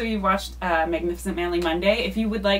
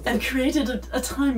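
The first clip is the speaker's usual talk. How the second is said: Stewie attempt; 3 semitones higher than usual. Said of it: "So we're gonna do Stewie".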